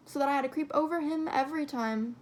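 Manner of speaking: plain reading voice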